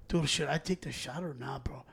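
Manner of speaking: 迷う友達を真似た、ひそひそ声; imitating a friend's unsure whisper, slowly; whispered worry. Friend's worried question retold.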